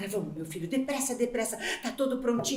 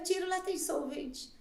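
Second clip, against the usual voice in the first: much softer self-reassurance; fading murmur; 12 dB quieter than usual. Acted. Mother reassuring herself about solvent, soft murmur. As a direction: murmur it to yourself.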